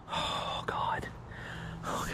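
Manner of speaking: hushed, breath-only dread; frightened whisper. Real, not acted. Vlogger lost in the woods, scared whisper. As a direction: whisper it under your breath.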